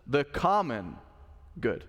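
"common" weighted and falling, "good" left light after a pause; stress on common. Shown as a demonstration. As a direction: press "common", let "good" drop. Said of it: "Emphasis is on common".

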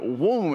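mock gunshot woom; imitated gun sound. Retelling the rifle firing, as a mock gunshot noise. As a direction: voice the rifle going off.

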